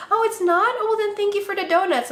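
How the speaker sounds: high, quick mock-casual voice; imitated coworker. Coworker shrugging off the mix-up, high and fast. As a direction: toss it off breezily, high and fast.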